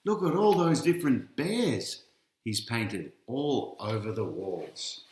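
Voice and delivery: ordinary delivery, nothing marked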